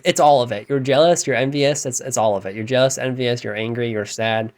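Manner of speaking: ordinary delivery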